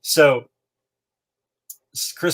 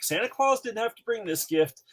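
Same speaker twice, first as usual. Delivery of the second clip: mock family lecture; 11 semitones higher than usual. Family voiced.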